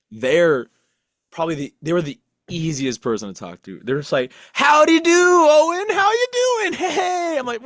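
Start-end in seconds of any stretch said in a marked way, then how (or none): 4.5-7.4 high, bouncy, laughing howdy voice; cheery friend. Retold or imitated. Friend Tyr greeting his depressed pal, high and cheery. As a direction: play the upbeat buddy who always greets you.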